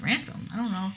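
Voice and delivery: lightly faked innocence; mock innocent. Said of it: "says ransom as if he doesn't know".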